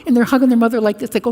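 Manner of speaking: normal talking voice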